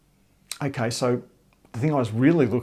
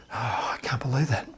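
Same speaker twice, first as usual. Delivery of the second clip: hushed disbelief; 7 dB quieter than usual. Retold or imitated. Retold colleague's disbelief.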